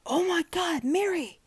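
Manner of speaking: put-on high, panicked old man's cry; high distraught wail; anguished cry. A narrator's reading. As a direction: cry out her name in horror. Old man finds wife dead.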